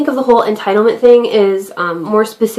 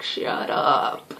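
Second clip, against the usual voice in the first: whispered snap; 8 semitones lower, 10 dB quieter than usual. Retold or imitated. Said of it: "you're like".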